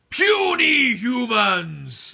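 very high, loud, slow booming villain cry; big Ocularius. Shown as a demonstration. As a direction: stretch it out, huge and booming. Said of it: "This is the big Ocularius".

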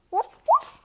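high, loud yappy woof; loud puppy bark. Acted. Puppy barks back at its owner, high loud woof. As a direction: yap it out like an eager little dog.

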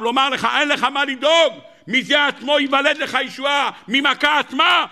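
high-pitched, drawn-out preacher's cry; impassioned preaching. Acted. Preacher telling his congregation God promises salvation, impassioned. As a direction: preach it high and intense, stretching the key words.

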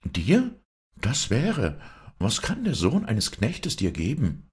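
high, incredulous questioning; loud high leap on the first word, then disbelief; scoffing wife. A narrator's reading.

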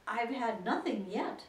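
slow, offended reply; hurt pride. A narrator's reading. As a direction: say it slowly, offended. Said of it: "replied in an offended tone".

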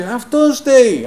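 high, loud and slow mock yell; imitating a shout. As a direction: call it out from far away like the angry coworker. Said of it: "και φώναζε".

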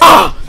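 high, loud yelp from a jump scare; startled scream. Real, not acted. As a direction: jolt out a sudden scream. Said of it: "what was that jump scare".

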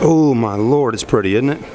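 usual speaking voice

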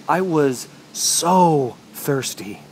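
"so" stretched long and slow; drawn-out emphasis on so. Acted. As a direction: dwell heavily on "so", parched. Parched narrator dwells on thirst.

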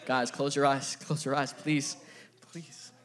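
normal talking voice

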